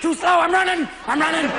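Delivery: too jumpy to wait for the cart; jittery golfer. Retold or imitated.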